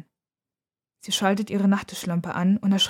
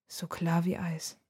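slow, hushed murmur; whispering; 9 dB quieter, slower than usual. A narrator's reading. Nora murmurs over the sliver of glass, whispering. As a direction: whisper it softly and slowly. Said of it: "flüstert sie".

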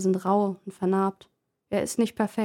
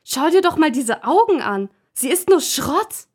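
put-on angry girlfriend; 7 semitones higher, 6 dB louder than usual. Leonie beschimpft die Puppe.

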